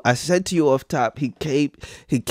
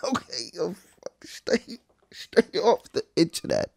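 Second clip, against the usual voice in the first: breathless whisper, softer; whispered through laughter; 3 semitones higher, 9 dB quieter than usual. Real, not acted. Streamer whispers to chat while still laughing. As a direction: gasp out the words.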